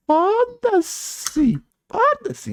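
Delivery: very high falsetto outburst; squealed dismissal. Real, not acted. Dismissing the argument about wrong motives, exasperated falsetto squeal.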